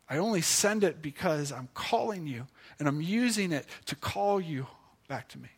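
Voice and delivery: quiet, reassuring appeal as God; tender invitation. Acted. Preacher voices God's merciful invitation.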